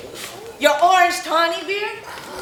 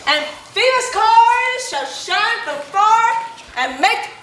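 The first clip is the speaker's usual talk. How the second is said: lofty tyrant's vein; 8 semitones higher than usual. Acted. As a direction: declaim it like a bombastic stage tyrant. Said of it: "This was lofty".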